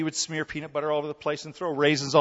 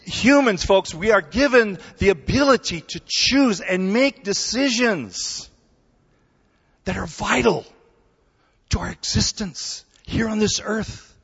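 louder, higher preacher's proclamation; full-force preaching; 8 semitones higher, 5 dB louder than usual. Acted. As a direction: drive the point home from the pulpit. Preaching the main point.

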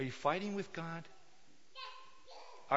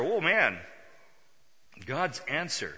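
normal talking voice

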